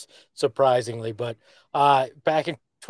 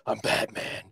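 hushed, whispered vigilante; soft whispery Batman impression; 3 semitones lower, 7 dB quieter than usual. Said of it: "is really just Batman".

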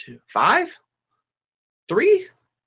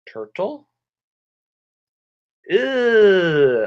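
loud drawn-out ew, pitched up; disgusted; 7 semitones higher, 3 dB louder, much slower than usual. Acted. Teacher reacting to turtles as food, disgusted. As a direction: recoil with playful disgust.

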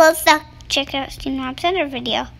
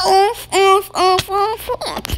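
imitated oof sounds; 4 semitones higher, much slower than usual. Minecraft damage sound imitation.

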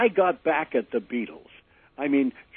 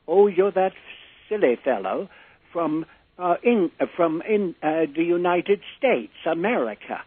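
British father on phone; 5 semitones higher than usual. Retold or imitated.

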